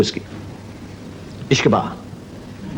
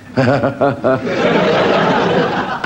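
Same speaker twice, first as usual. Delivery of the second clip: long acted staccato laugh; nervous forced laugh. Farmer learns son-in-law is customs, forced laugh. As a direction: laugh it off, stalling.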